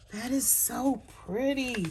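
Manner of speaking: high, slow swoon; awed delight. Real, not acted. Crafter showing patterned paper to viewers, awed swoon. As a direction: melt over the pretty paper.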